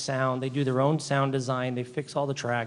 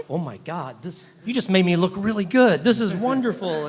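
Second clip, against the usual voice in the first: delighted amazement; 4 semitones higher, 5 dB louder than usual. Retold or imitated. Retells delighted reaction to colleague's work.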